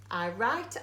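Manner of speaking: normal talking voice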